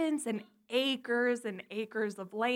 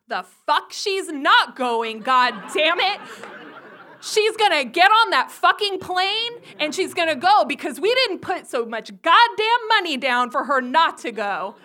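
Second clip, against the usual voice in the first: loud, raised-pitch furious outburst; acted angry dad; 5 semitones higher, 9 dB louder than usual. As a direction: lay down the law as an outraged father.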